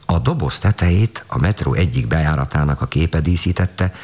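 ordinary delivery, nothing marked

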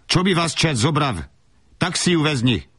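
high-pitched angry outburst in Slovak; snapped rebuke. Acted. Mother cursing the couple as she gives in, snapped rebuke. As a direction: fling the curse out in a high voice. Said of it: "és ezzel rivalt rám".

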